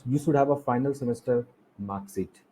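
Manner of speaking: normal talking voice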